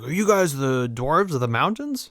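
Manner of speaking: lightly put-on official's voice; mayor of Lake Town. Mayor quizzing the dwarves, lightly voiced character. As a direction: question them with a touch of pomp. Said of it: "the mayor of Lake Town".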